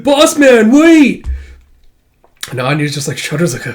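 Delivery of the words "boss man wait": high, loud sidekick yell; hammed-up plea to wait; calling after someone. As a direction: holler after the fleeing boss, stretching it out. Sidekick calls after fleeing boy.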